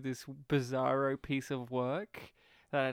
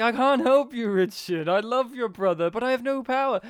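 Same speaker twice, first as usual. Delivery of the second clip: loud, higher, stiff wooden line delivery; imitating a wooden policeman, louder and higher; mock bad actor; 7 semitones higher, 9 dB louder than usual. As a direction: ham it up like a bad actor pleading. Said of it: "You could tell he was a bad actor".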